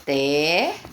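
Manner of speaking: "Terra" broken in two, long first syllable, pause, then "ra"; syllable split. Shown as a demonstration. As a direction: stretch and separate the syllables of "Terra". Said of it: "o R separado do outro".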